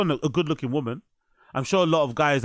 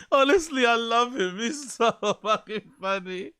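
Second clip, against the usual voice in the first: laughing; 6 semitones higher than usual. Real, not acted. Laughing after the impression.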